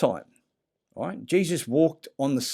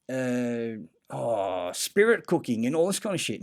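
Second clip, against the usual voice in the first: ordinary talk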